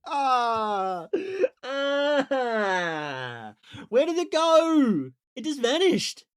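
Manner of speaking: real comic anguish over the lost turn; whiny despair. Real, not acted. Streamer wailing to chat about the vanished turn, half-crying whine.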